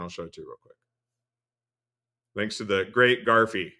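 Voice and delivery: ordinary talk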